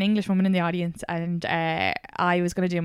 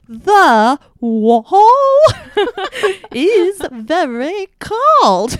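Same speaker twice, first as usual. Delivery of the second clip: very slow, high and loud posh English voice, every letter pronounced; mock posh British; 11 semitones higher, 12 dB louder, much slower than usual. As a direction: ham up a posh Brit dragging out each word. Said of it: "they're like".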